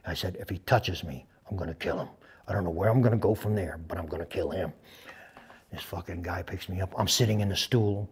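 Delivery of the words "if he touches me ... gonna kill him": low, hushed resolve; quiet threat. Retold or imitated. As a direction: state the threat softly, dead serious. Retold vow to kill interrogator.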